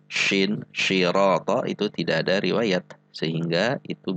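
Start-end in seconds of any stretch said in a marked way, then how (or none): 0.1-1.7 shirata with a hushing SH; shin in shirata. Shown as a demonstration. Warning learners of a reading with no riwayat, hushed SH shirata. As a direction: open "shirata" on SH instead of S. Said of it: "diubah dengan huruf shin".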